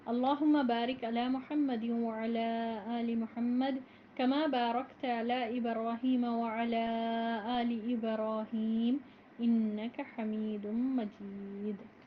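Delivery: slow, measured recitation of the opening prayers; formal Arabic recitation. Acted. (آہستہ، باوقار عربی تلاوت)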